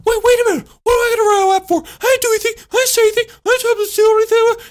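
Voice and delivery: high, loud indignant whine; upset protest. Retold or imitated. Drew protests to the teacher, high loud indignant whine. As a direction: protest like it's deeply unfair. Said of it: "he gets all upset".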